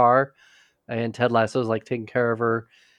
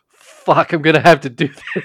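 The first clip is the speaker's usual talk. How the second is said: exasperated outburst; 3 semitones higher, 9 dB louder than usual. Retold or imitated. Roy resigned to stepping in.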